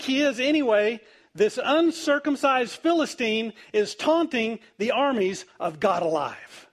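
high, forceful dramatic reading; bold challenge. Retold or imitated. David asks the soldiers about Goliath, bold challenge. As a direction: read it out as a ringing, indignant challenge.

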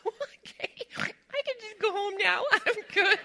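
high, squeaky overwhelmed voice with a laugh; mock tearful. Mother reacting to the signed cookbook, mock tearful. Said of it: "We were all crying".

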